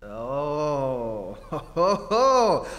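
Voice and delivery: loud mocking ho ho laugh; knowing laugh. Reporter laughing loudly as he sees through the ex-husband. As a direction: laugh as it dawns on you.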